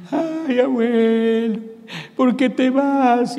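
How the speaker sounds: high, loud mocking funeral wail; mock wailing mourner. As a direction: ham up a crying relative at the coffin.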